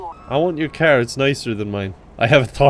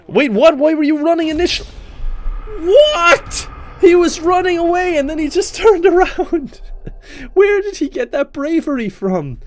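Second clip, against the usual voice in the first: shocked disbelief; 14 semitones higher than usual. Real, not acted. Gamer yelps in disbelief at fleeing suspect. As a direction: squeal it out in shock.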